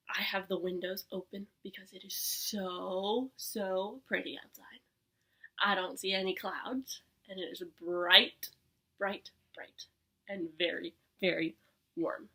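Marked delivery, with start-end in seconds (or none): 0.1-7.6 whisper. Acted. Delighted about the weather.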